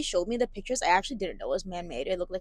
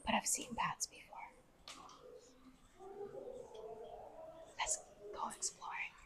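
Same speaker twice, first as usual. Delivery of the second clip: whispering; 8 semitones higher, 6 dB quieter than usual. Real, not acted. Whispering in a cave.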